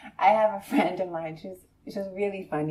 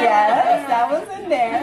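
emphatic yes; 6 semitones higher, 11 dB louder, slower than usual. Retold or imitated. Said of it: "We're like".

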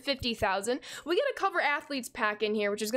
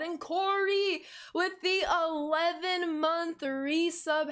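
hype announcer; 5 semitones higher, slower than usual. Acted. Hyping a sub announcement.

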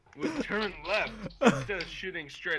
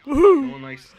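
loud shout; 9 semitones higher, 15 dB louder, slower than usual. Real, not acted. Shouting mid-game chaos.

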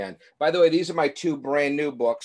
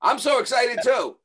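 higher, louder, eager echo; excited; 8 semitones higher, 5 dB louder than usual. Real, not acted. Echoing a child's excited message.

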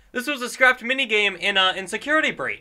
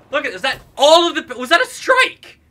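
excited; 7 semitones higher, 7 dB louder than usual. Real, not acted. Excited about strike.

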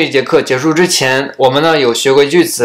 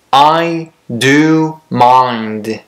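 very slow model reading; much slower than usual. Shown as a demonstration. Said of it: "teacher models slow reading".

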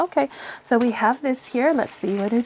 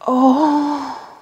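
usual speaking voice

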